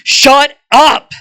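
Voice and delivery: loud, high drill-sergeant yell; commanding shout. Acted. Drill-sergeant student silences her rival, commanding shout. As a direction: bellow it like an order to a recruit. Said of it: "In a very commanding voice".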